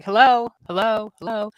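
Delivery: calling hello. Retold or imitated. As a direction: call out to get his attention. Calling out to an oblivious ghost.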